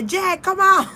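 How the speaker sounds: high, loud call into the room; calling the dog. Retold or imitated. Calling her dog Jet out of the smoky room, high loud call. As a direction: shout for the dog to come out.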